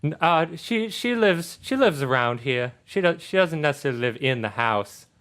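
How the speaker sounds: put-on sweetly sociable girl's voice; friendly Charlotte. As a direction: gush like a daughter about her best friend.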